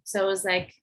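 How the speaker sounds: ordinary delivery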